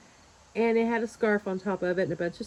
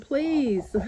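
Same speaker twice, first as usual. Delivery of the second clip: higher-pitched imploring please; retold plea, pitched up; pleading; 6 semitones higher than usual. Buyer begs eager helper to leave the mirrors, pleading.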